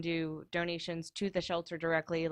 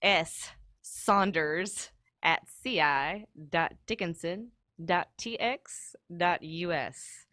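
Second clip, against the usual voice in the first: spelled out slowly; slow, careful dictation; much slower than usual. Shown as a demonstration. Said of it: "I will say it slow".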